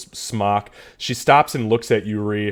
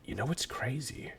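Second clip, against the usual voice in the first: hushed dwarf; 17 dB quieter than usual. Acted. Old dwarf close, nose to nose.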